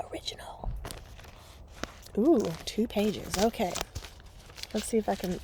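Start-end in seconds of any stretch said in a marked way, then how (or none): 0.1-0.7 breathy whispered repeat. Shown as a demonstration. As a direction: whisper the word original. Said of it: "Also my original".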